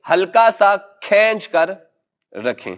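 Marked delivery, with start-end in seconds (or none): none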